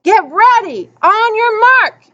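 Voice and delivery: high starter's call, stretched at the end. A narrator's reading. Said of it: "and Bump called".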